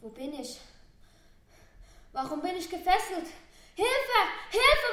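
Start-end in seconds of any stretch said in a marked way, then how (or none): 3.7-4.9 calling for help. Acted. Tied-up girl calls for help.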